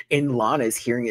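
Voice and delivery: usual speaking voice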